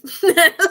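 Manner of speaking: a sudden high laugh that bursts out; real burst of laughter. Real, not acted. Laughing at [name] comparing to Tom Bombadil with a high laugh. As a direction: laugh out loud at your own comparison.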